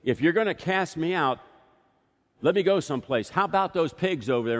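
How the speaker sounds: a hint of a desperate demon bargaining; pleading demon. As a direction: wheedle for a way out. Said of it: "as he pleads with Jesus".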